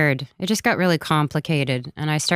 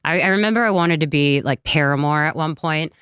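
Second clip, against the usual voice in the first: ordinary talk, nothing marked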